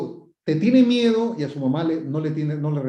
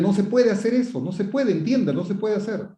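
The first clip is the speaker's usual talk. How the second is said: higher-pitched, insistent parental scolding; stern, forceful scolding; 6 semitones higher than usual. Shown as a demonstration. Parent ordering a child to understand, stern and insistent. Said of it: "Le estoy hablando fuerte".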